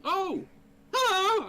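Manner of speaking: high, loud, bubbly hello; excited greeting. Acted. Fenwick waving excitedly at the gnome, high and loud. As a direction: burst out in delight.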